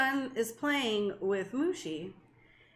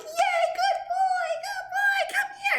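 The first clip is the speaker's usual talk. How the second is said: coaxing dog voice. Retold or imitated. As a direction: lure the dog in with baby talk. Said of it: "And I was like".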